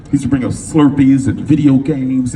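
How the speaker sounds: usual speaking voice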